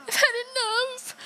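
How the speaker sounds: high, sobbing cry on had. Acted. Stewie wailing that he has had enough. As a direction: break down on it. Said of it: "Stewie climax of despair".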